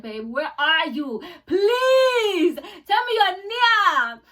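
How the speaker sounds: high, loud, hammed-up pleading; mock panicked wail. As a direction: wail desperately for rescue, playing it up. Acting out panicked call to partner.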